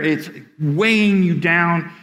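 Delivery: ordinary talk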